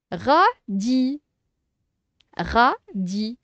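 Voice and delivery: "Radis" split into "ra" and "di", each lifted; syllable by syllable. Shown as a demonstration. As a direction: say "Radis" in two separate teaching beats. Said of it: "on va séparer les syllabes".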